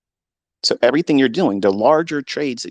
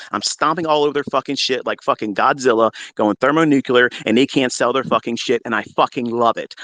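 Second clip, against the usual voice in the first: usual speaking voice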